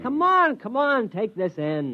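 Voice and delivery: impatient high coaxing; eager urging. Acted. Urging friend to help.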